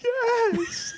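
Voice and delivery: very high sobbing wail; hammed-up sob; mock crying. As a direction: imitate a crying girl. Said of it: "crying her eyes out".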